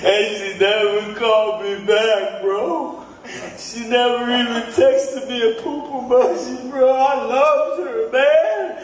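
put-on high, slow, whiny drunk lament; drunk heartbreak. Drunk comedian at hour five, whining about the girl who left.

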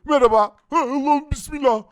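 high, loud jumpy greeting; startled yelp. Real, not acted. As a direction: jump at the sudden visitor. Mob appears, startled greeting. (irkilmiş yüksek ses)

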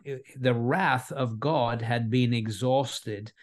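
normal talking voice

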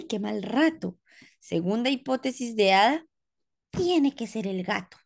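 usual speaking voice

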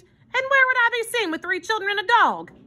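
high-pitched indignant question; demanding. A narrator's reading. Armand balking at children and a dog, indignant demand. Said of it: "he demanded".

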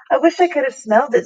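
plain reading voice

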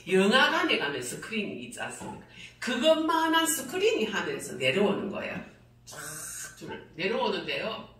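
usual speaking voice

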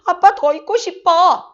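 조르는 딸. Retold or imitated. 딸이 친정아버지에게 조름.

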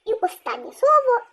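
usual speaking voice

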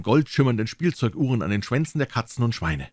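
ordinary delivery

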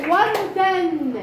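وردن stretched very slowly, syllable by syllable; slow model reading. Shown as a demonstration. Teacher modelling وردن for the class, very slowly. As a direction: draw out وردن slowly so pupils can follow. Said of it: "زين،".

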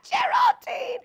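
thrilled to see a viewer's message; delighted. Real, not acted.

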